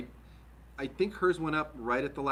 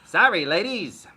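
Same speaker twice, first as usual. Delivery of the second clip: mock Canadian accent; 6 semitones higher, 11 dB louder, slower than usual. Repeating the Brad joke.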